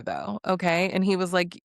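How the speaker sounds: ordinary talk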